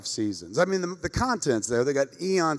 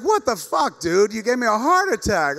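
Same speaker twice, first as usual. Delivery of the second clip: high, loud exasperated protest; re-enacted shocked scolding, pitched right up; retold outraged yell; 7 semitones higher, 4 dB louder than usual. Answering friend's false alarm, outraged.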